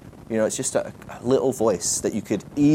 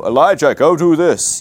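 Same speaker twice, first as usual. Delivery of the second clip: a mock-booming God voice, louder; booming voice from heaven; 5 semitones higher, 7 dB louder than usual. Preacher imitating God calling Elijah, mock booming. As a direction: boom it out like a voice from the sky. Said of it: "booming voice from heaven".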